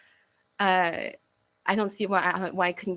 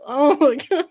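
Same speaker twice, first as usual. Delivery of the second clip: mock disgusted gasp of shock, higher and louder; disgusted oh my God; 6 semitones higher, 8 dB louder than usual. Vlogger acting out her body's disgust, shocked. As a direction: recoil at the thought. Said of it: "my body's response is like".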